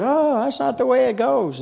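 high, indignant objection; mock holler. Church lady scolding the pianist, mock high holler. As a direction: squawk it like a correcting old lady. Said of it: "she hollered at her".

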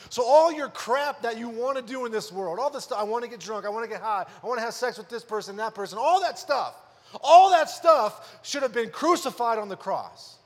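preacher reading scripture aloud with force; preaching scripture reading. Acted. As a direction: proclaim the verse to the congregation.

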